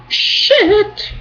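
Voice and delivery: a very high, squeaked "shit"; squeaky mock shit. Real, not acted. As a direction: squeak the word out as a joke. Squeaky cap on a rant.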